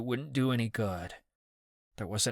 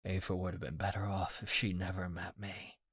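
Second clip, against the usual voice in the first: softer, defeated; hollow and quiet; 3 semitones lower, 8 dB quieter than usual. A narrator's reading. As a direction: say it like you've given up. Broken confession.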